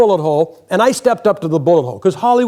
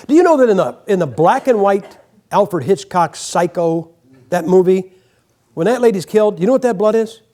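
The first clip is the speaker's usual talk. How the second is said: ordinary delivery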